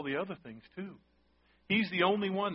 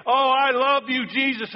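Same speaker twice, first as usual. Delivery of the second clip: hammed-up boastful declaration; mock boast; 8 semitones higher, 9 dB louder than usual. Pastor mocking a boastful believer, hammed-up and loud. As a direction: puff up a showy "I love you". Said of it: "stand up and boast and say".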